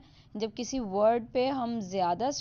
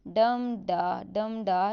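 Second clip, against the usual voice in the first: louder DUM da, DUM da chant; rhythm beat; 5 dB louder, much slower than usual. Shown as a demonstration. Teacher to students chanting the meter's beat, louder. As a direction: beat it out, strong then weak. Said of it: "ये वाला rhythm है".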